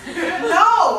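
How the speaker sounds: high loud no; scream. Acted. Mark stopping the roommate calling police, shouting. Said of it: "I screamed".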